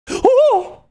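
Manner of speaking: high loud startled oh; shocked oh. Real, not acted. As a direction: yelp out in shock. Shocked at a line in the book.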